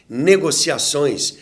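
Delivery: ordinary delivery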